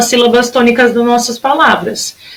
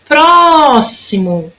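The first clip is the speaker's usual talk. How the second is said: "Próximo" called high with a long "Pró"; slow, raised "Pró" in "Próximo"; stretched first syllable; 4 semitones higher, much slower than usual. Shown as a demonstration. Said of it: "Durou mais tempo".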